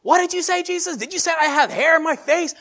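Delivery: very high, loud confused shout; comic shouted mishearing. Acted. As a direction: yell back over the storm, mishearing. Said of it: "everyone has to yell".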